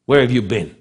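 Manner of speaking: usual speaking voice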